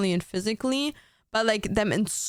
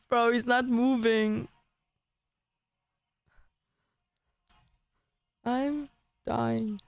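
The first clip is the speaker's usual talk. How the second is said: faint, slow mock-dying voice; hammed-up dying groan; dying words; slower than usual. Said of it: "I'm pretending like I'm dying".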